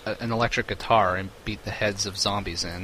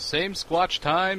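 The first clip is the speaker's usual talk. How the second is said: announcer sign-off; 9 semitones higher, much slower than usual. Acted. Parody show sign-off.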